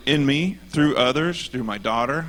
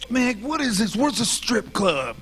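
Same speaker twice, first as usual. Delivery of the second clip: much higher goofy fan voice, slow at the end; imitating the fan; 11 semitones higher than usual. Retelling a rowdy concert fan, high mock voice. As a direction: play the clueless fan, high and drawn out. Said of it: "and he's like".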